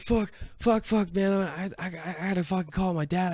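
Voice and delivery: high, rushed, stammering panic; panicked. Retold or imitated. To friends, dreading calling his dad, high frantic stammer.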